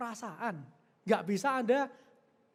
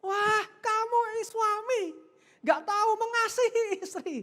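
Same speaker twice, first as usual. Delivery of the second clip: hurt wife; 10 semitones higher, 4 dB louder than usual. Acted. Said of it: "hatinya sakit".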